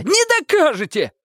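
very high, thin challenge; squealed defiance. A narrator's reading. Detainee challenging the officers' evidence, defiant high squeal. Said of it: "взвизгнул Лысый".

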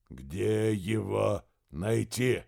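very slow, heavy demand; heavy, crushing. Acted. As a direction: press each word down. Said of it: "Слова, произнесённые ведуном, были тяжелы, как гранитные плиты".

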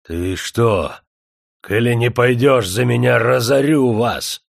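loud, slow threat; threatening. A narrator's reading. Rejected suitor threatens the girl and her father, loud slow threat. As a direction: threaten to ruin them. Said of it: "пригрозил Демидов".

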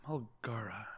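breathy whispered repeat of the word. Real, not acted. A contestant whispering the strange name to herself. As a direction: mutter the name to yourself under your breath.

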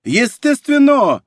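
high, loud, drawn-out exclamation; emphatic exclamation. A narrator's reading. Middleman justifying his fee to a client, emphatically. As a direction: proclaim it slowly and loudly, as beyond question.

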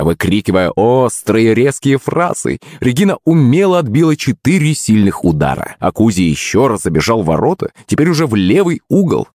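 usual speaking voice

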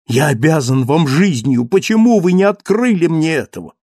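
high, loud, urgent plea; impassioned reproach. Acted. Acted reproach from grateful Marius.

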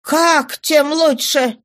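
slow, high indignant outcry; exclaiming. Acted. Wife protests husband's indifference, high indignant exclamation. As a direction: throw it back high, drawn out. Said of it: "воскликнула жена".

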